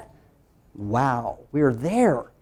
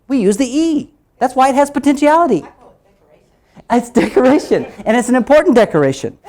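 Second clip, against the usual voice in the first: loud, raised pitch, driven home; emphatic proclaiming; 4 semitones higher, 6 dB louder than usual. Real, not acted. Teacher telling learners every syllable needs a vowel, loud and emphatic. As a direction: proclaim the rule loudly.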